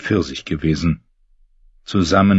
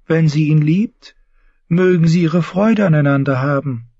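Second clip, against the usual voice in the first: put-on higher, devout young knight; Lancel; 10 semitones higher than usual. Lancel confessing his new faith to James, earnest. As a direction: speak like a pious, fervent cousin.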